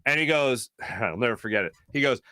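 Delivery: ordinary talk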